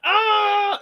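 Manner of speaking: short high startled shriek; re-enacted shriek at the escaped bird; startled scream. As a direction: let out a sudden high cry. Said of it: "that was my reaction".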